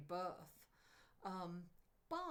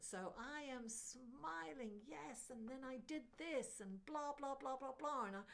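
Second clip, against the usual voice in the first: animated and excited; 5 semitones higher than usual. Shown as a demonstration. Showing the reading client how an animated voice engages. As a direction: bubble along as if thrilled. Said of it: "and I'm animated, and I'm excited".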